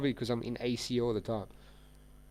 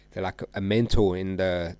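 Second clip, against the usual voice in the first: ordinary delivery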